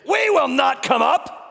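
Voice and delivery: defiant shout. Acted. Rebels refusing Moses, shouting defiantly. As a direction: shout it back in open defiance.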